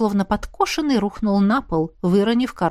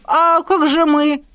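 slow, high-pitched protest at being left out; drawn-out aggrieved question; indignant; 7 semitones higher, 4 dB louder, slower than usual. A narrator's reading. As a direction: wail it in offence. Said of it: "возмутился молчавший до этого Дин".

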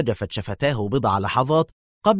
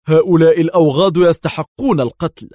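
loud, raised-pitch angry outburst; sharp anger; 6 semitones higher, 8 dB louder than usual. A narrator's reading. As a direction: say it sharply, with real force. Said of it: "قال دار في حدة".

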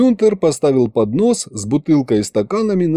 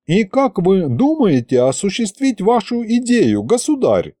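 higher, courteous archivist; polite high voice; 9 semitones higher than usual. A narrator's reading. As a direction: ask politely, voice raised. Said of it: "вежливо спросил Витарамус".

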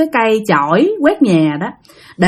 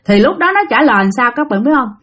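usual speaking voice

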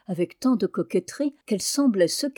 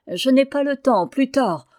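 sourire infernal; 5 semitones higher, 6 dB louder than usual. A narrator's reading. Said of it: "me souriant d'une infernale façon".

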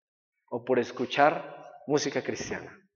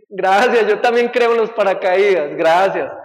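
ridiculous; 3 semitones higher, 8 dB louder than usual. Acted. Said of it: "bien ridículamente y dice".

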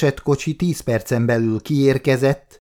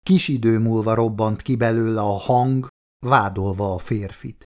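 ordinary delivery

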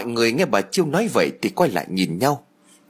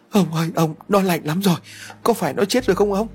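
a touch of frightened pleading, higher; fearful mother begging her husband for an answer; trembling fear; 8 semitones higher than usual. Acted. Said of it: "Bà run rẩy rồi nói". (run rẩy sợ hãi, giọng cao hơn)

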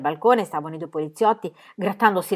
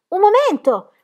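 much higher, louder call; 12 semitones higher, 7 dB louder, slower than usual. A narrator's reading. Girl calling to policemen before running to kitchen, shouting. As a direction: shout it as you dash off. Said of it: "gridò Pippi".

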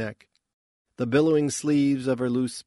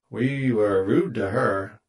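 buzzed; 5 dB louder, slower than usual. A narrator's reading. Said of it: "Plus buzzed".

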